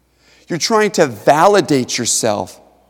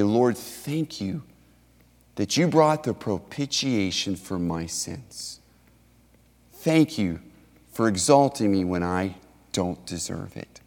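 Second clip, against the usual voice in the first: humble prayer; 5 semitones lower, 6 dB quieter than usual. Acted. Believer thanking the Lord for forgiveness, soft humble prayer. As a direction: pray it quietly and humbly.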